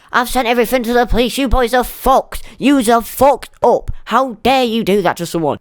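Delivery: imitating a furious parent on a livestream; angry mother. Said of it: "her mother was on the live saying".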